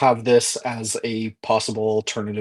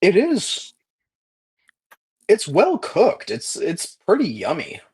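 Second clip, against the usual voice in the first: high sing-song food review; high cheerful voice; 8 semitones higher than usual. Acted. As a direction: chirp it like a happy taster. GM voicing the taste.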